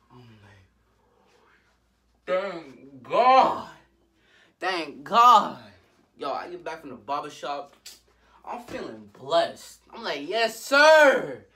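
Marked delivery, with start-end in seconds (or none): 2.3-5.6 loud, drawn-out cry of thanks; hammed-up shout of gratitude; exaggerated relief. Back from the barbershop, relieved. 10.3-11.4 high, loud, stretched-out exclamation; showy shout of approval; hyped-up cheer. Retold or imitated. Hyped about his fresh haircut.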